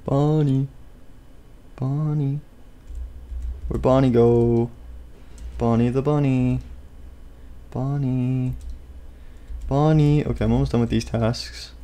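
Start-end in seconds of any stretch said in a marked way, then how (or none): 0.0-10.2 soft, drawn-out singsong calling; playful hushed calling, lingering on the name; soft coaxing call. Pretend. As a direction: call gently for someone hiding. Calling softly for the hidden Bonnie.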